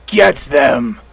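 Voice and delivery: a lightly voiced villainess barking an order; hint of a wounded villain; dragon lady command. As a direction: snap the order at the party. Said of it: "and she goes".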